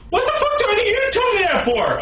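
high-pitched angry demand; exasperated rant. Acted. Ranting at the haters.

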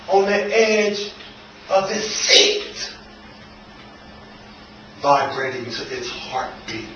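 soft, slow phrases with long pauses; hushed and slow. Acted. Waking to see the soul in the corner, hushed. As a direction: drop to a hush, phrase by phrase. Poem turns to his soul.

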